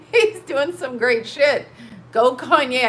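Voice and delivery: cheering him on while still laughing. Real, not acted. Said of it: "this is making me laugh".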